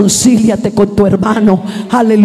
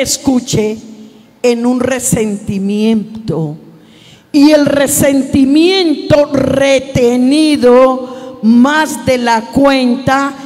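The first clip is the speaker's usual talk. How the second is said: slow, weighty preaching; slower than usual. Acted. Pastor warning the church about bitterness, slow solemn preaching. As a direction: lean into every phrase, slow and heavy.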